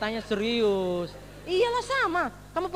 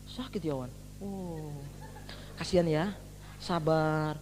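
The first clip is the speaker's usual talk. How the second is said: lower, softer fake sympathy; mock pity; 9 semitones lower, 6 dB quieter, slower than usual. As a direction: friends pretending to care at the bedside. Teman negatif pura-pura kasihan. (teman pura-pura kasihan dengan suara rendah)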